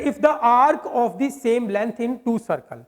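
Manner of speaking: reading the question word by word, very slow; slow dictation reading. Shown as a demonstration. Teacher reads the exam question to students, very slow. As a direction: spell out each word of the question slowly. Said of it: "कह रहे".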